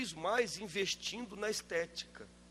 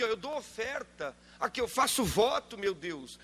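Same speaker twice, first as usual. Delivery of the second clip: higher, whiny bargaining with God; demanding churchgoer; 6 semitones higher than usual. Retold or imitated. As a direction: mimic a churchgoer haggling with God. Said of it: "gente assim na igreja".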